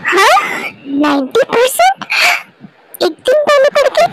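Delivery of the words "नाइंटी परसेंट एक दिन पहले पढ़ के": disbelief. Acted. Daughter doubts mom's boast.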